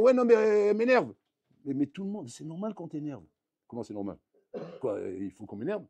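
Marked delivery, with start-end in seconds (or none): 0.0-1.1 loud, high-pitched exasperated protest; acted annoyance, whining back in self-defence; irritated complainer. Rabbi imitating the man who gets irritated, exasperated. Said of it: "Un goy, il s'énerve".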